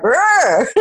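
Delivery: a short, high, put-on growl of frustration; mock angry growl. Retelling her reaction to being called emotional, mock growl. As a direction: growl like an irritated cat. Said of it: "I'm like".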